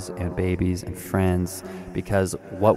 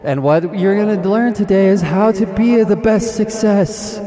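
guru hype climax; 10 semitones higher, 5 dB louder than usual. Acted. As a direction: stretch best success like a stadium chant.

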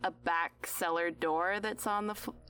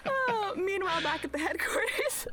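laughing. Real, not acted. Laughing scene switch.

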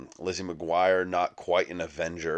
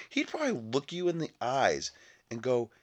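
usual speaking voice

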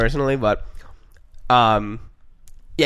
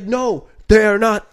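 raised, slow mock scream; dad screaming; 7 semitones higher, 6 dB louder, slower than usual. Son imitating dad screaming denial, raised voice. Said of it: "he was screaming at the top of his lungs".

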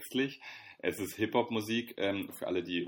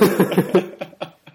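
high laugh; 12 semitones higher, 13 dB louder than usual. Real, not acted. Laughing at his friend's joke about looking like a dad, loud high laugh.

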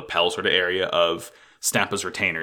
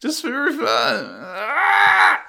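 exasperated yelp; much slower than usual. Real, not acted. Host fed up with the show, shrill yelp. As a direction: shriek of frustration, pitch jumping way up.

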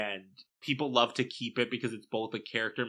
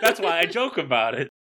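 loud, high, laughing through the words; 7 semitones higher, 8 dB louder than usual. Real, not acted. Podcast host excusing a dark joke, laughing loudly.

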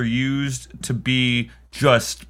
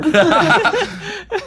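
high loud laugh; 5 dB louder than usual. Real, not acted. Laughing at the pun.

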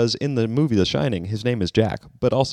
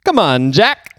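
too-energetic politician impression, high and loud; Joe Biden; 7 semitones higher, 5 dB louder, slower than usual. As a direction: overdo it with loud, pitched-up energy. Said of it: "That's too energetic".

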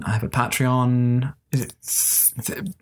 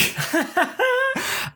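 high laugh. Real, not acted. Seeing herself in the ad.

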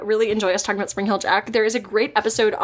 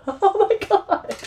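laughing; 9 semitones higher, much slower than usual. Real, not acted. Cat being cute.

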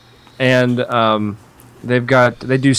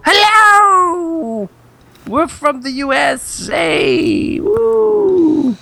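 very high, drawn-out falsetto greeting; Missus Doubtfire; 13 semitones higher, much slower than usual. Pretend. Fan jumps up to greet people in their driveway, hooting falsetto. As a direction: hoot it out in a sing-song falsetto. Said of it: "yell out "hello" like Missus Doubtfire".

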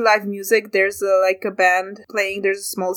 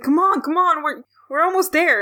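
higher-pitched, eager urging; 8 semitones higher than usual. Acted. Frog to Willow running up, urging on. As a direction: hurry a teammate along.

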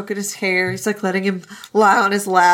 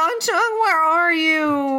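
whiny drawn-out call; 7 semitones higher, slower than usual. Retold or imitated.

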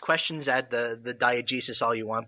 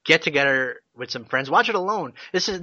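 ordinary talk, nothing marked

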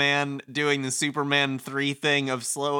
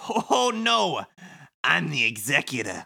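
sly villain Kubo. Acted. Plays Kubo reading game script.

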